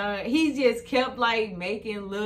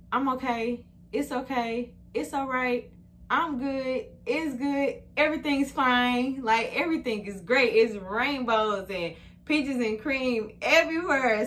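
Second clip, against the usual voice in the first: higher, insistent self-reassurance building louder; mock everything's-fine act; 5 semitones higher, 4 dB louder than usual. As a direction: insist brightly that all is fine. Mimics herself pretending all is fine.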